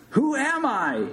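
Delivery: high-pitched hollered question; loud shout. A narrator's reading. Big Bird demands patient identify him, shouting. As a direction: yell the question at someone hiding. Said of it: "he shouted loudly again".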